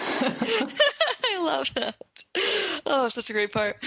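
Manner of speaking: laughing through the words, slow; I love that, cracking up; slow giggly delight. Real, not acted. Laughing at email.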